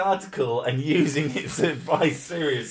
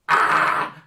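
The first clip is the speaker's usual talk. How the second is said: frustrated yell; 3 semitones higher, 8 dB louder than usual. Real, not acted. Frustrated outburst.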